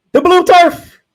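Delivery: loud, high hype call; excited shout. Real, not acted. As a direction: shout it like a big reveal. Announcing the famous blue field.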